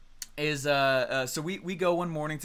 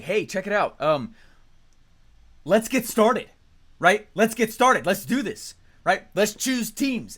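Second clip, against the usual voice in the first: loud, higher pep-up call; rallying the group; 5 semitones higher, 6 dB louder, slower than usual. Retold or imitated. Calling out to the gym crowd to pick teams, loud and eager. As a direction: pump up the crowd to start the game. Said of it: "I started going like".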